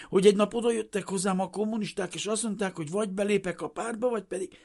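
high, fast, anxious rush; frightened explaining. A narrator's reading. Refugee with trembling knees explaining to the official, frightened. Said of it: "sápadtan és remegő térdekkel".

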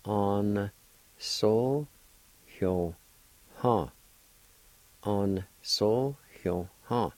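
sounded out slowly, syllable by syllable; slow teaching pronunciation; slow careful pronunciation. Shown as a demonstration. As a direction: stretch each syllable so learners can follow. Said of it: "with the first A like this".

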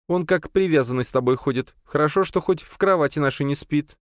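lightly voiced playful woman; a hint of teasing young wife. A narrator's reading. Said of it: "хихикнула Зина". (лёгкий женский голос с усмешкой)

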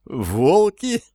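high, nervous question; trembling with fear; scared cat. A narrator's reading. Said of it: "затрясся кот".

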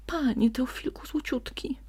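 high, hushed syrupy plea; butter him up sweetly; sweet wheedling. A narrator's reading. Sly fox sweet-talks the donkey. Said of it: "prosił słodko Ziółko".

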